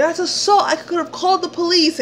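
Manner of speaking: high-pitched indignant warning; insistent accusation. Retold or imitated. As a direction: drive the accusation home, high and heated. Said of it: "I told him over and over".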